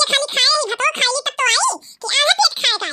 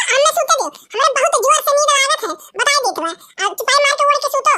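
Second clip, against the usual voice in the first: usual speaking voice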